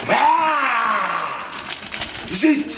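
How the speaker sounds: startled exclamation. Acted. Realizes he said the word.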